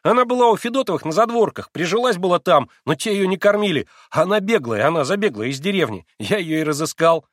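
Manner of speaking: high, breathless-with-delight explaining; frenzied, delighted exclaiming. A narrator's reading. Boy explaining to his sick friend, elated and insistent. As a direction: rattle out the proof with beaming excitement. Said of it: "восклицал неистово Коля с разгоревшимся и сияющим от восторга лицом".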